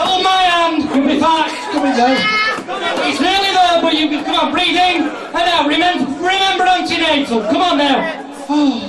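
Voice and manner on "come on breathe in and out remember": ordinary delivery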